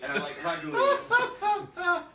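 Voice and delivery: laughing fake Italian accent; hammed-up Italian, slow and high; mock Italian accent. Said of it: "You said Italian".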